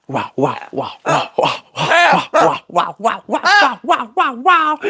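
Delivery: sing-song praise for a trick; cheering wows. Real, not acted. Praising bird doing a spin.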